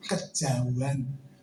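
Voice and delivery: deep, slow, hushed voice of God; the Lord speaking low and soft to Vincent. Acted. God's voice continues.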